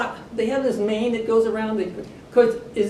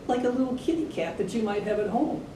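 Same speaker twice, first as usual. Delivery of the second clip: ordinary delivery, nothing marked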